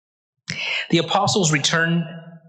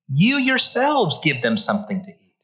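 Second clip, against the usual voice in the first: "You yourselves" pitched up, then falling away; emphatic YOU YOURSELVES; 2 semitones higher than usual. Retold or imitated. Preacher quotes Jesus answering his disciples, emphatic stress on "You yourselves". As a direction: lean hard on "You yourselves". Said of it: "this is emphatic".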